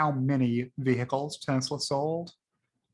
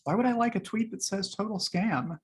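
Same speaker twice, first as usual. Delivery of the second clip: incredulous question; 6 semitones higher than usual. Real, not acted. Puzzled why he liked tweet.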